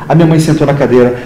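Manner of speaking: ordinary talk, nothing marked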